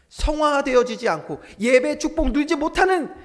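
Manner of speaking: loud, high-pitched pulpit cry; impassioned preaching. Real, not acted. Preaches at full force.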